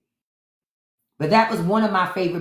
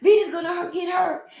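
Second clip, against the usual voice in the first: much higher, fretful falsetto; imitating a worried lady; 11 semitones higher than usual. Townswoman warning the ladies Vida will get hurt, high fret. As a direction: squeal it like a panicked townswoman. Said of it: "Stalker was trying to tell them".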